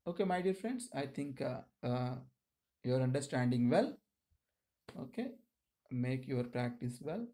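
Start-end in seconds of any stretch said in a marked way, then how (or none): none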